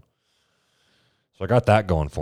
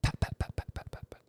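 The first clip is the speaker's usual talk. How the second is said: whispered pat pat of heels fading off; heel clicks; 8 dB quieter than usual. Retold or imitated. Storyteller mimicking the doctor's heels on marble, whispered. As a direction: mimic footsteps walking away. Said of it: "heels on the marble floor".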